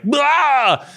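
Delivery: silly high exclamation; mock blurt. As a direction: blurt it out like a train crash. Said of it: "the effect was like".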